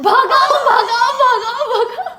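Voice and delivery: high, loud shout through laughter; laughing shout. Retold or imitated. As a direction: urge the auto driver to speed off, laughing. Fleeing in auto, urging driver.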